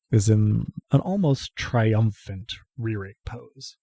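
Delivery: soft, slow game master narration with long pauses; hushed scene narration. A narrator's reading. Game master to player waking in the chancel, hushed. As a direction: draw each phrase out quietly and solemnly.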